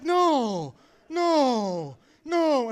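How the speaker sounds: loud, wailed refusal; horrified denial. Acted. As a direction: cry out in hammed-up despair. Horrified reaction to Matt's answer.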